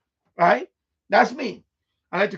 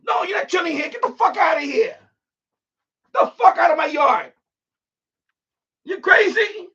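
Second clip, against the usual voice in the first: high, loud yell chasing him out; retold shouting; 8 semitones higher, 4 dB louder than usual. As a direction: throw him out of the yard, shouting. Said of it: "I'm like".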